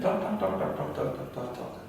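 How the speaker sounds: soft, rattled-off repeats fading away; quick, low-volume patter; rapid muttered chatter. Shown as a demonstration. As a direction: chatter on and on, trailing into near whisper. Mimics non-stop chatter, fading.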